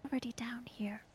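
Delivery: hushed whisper in the dark. Acted. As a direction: breathe it out under the breath.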